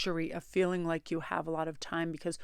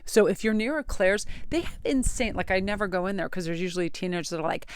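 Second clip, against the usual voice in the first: usual speaking voice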